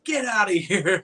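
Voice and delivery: high, put-on telling-off with a laugh; mock angry dismissal. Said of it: "looks like he's saying".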